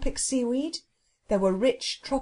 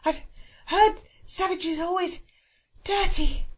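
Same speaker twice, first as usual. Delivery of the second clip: high, broken-up gasps between words; out of breath from running, pitched high; breathless; 8 semitones higher than usual. Acted. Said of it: "his breathless words".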